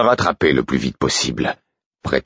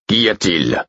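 annoyed; 3 semitones higher, slower than usual. A narrator's reading. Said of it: "demanda Burick d'un ton agacé".

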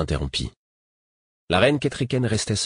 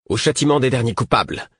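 fiery, raised and higher; with fire; 6 semitones higher, 3 dB louder than usual. Acted. Rebel leader to the queen, fiery demand. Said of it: "déclara Mercureuil avec feu".

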